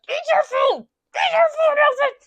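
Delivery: high-pitched, hammed-up furious command; mock angry yell. Captor shoves sandwich at captive, mock angry yell. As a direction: screech it like an enraged captor, imitated.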